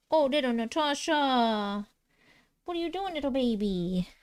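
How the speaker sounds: soft cooing pet voice. Real, not acted. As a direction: coo gently to the little one.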